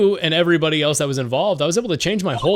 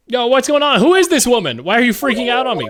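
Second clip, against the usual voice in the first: higher, louder, rushing indignation; hammed-up worked up; 6 semitones higher, 5 dB louder than usual. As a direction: play it overdramatic on purpose. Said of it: "more dramatic if I got all worked up".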